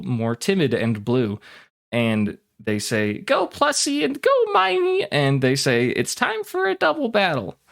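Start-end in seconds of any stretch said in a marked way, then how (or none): 3.2-5.1 put-on high, childlike twin reciting a script; little girl voice. Twins send out Pokemon. 5.9-7.6 put-on high, childlike twin reciting a script; little girl voice. Twins declare battle.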